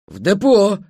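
short, loud, high bark; barked reply. A narrator's reading. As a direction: snap it back loudly. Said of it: "рявкнул Пегаса". (рявкнув, громко и коротко)

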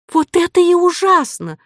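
very high-pitched indignant cry; outraged heroine's high exclamation. A narrator's reading. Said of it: "крикнула Ариадна".

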